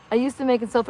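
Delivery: ordinary delivery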